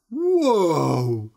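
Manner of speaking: long, loud, falling exclamation of wonder; amazed whoa. A narrator's reading. Big Peter hears the huge price, astonished. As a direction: draw it out, hugely impressed.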